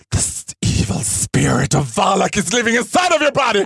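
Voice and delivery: retold exorcism on the sofa, shouted; mock exorcist shout. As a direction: cast the demon out, booming. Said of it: "blir så här livrädd själv och bara".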